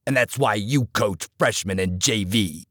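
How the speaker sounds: low, hard coach voice cutting him down; stern older coach. Acted. Coach scolding the assistant in the dugout, low and hard.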